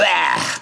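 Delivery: high, sharp yuck noise; mock disgusted gag. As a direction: imitate recoiling from a foul drink. Reaction after swig of urine.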